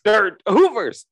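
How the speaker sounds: loud, high burst of recognition; excited shout. Real, not acted. Suddenly recalling the brand name.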